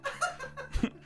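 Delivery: squealing laugh; high burst of laughter. Real, not acted. Big laugh.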